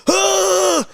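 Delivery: high startled yelp; scream. Retold or imitated. Man startled by a rat in the bathroom, high yelp.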